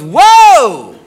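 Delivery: loud drawn-out mock whoa; loud exaggerated whoa of alarm; startled whoa. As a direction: act out a big, loud reaction. Girlfriend's startled reaction retold.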